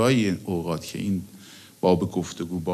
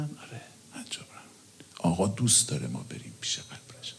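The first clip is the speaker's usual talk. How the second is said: whisper; 2 semitones lower, 8 dB quieter than usual. Real, not acted. Intimate remark about the Imam.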